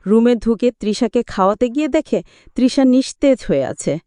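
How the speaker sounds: usual speaking voice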